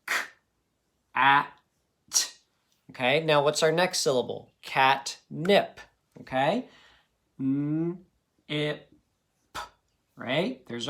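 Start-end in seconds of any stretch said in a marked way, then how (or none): 0.1-1.5 "k, at" split into separate sounds; sounding out each sound. Shown as a demonstration. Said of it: "one syllable at a time". 7.4-8.9 "n, ip" said as separate sounds; sounding out each sound. Shown as a demonstration. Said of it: "one syllable at a time".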